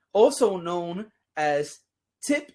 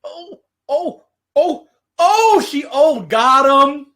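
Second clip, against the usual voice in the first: high loud ohs; excited yelps; 7 semitones higher, 10 dB louder, slower than usual. Pretend. Acting out partner's reaction.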